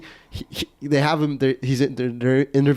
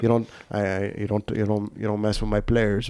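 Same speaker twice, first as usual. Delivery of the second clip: put-on Spanish accent; 4 semitones lower, 4 dB quieter than usual. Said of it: "that's how he talks".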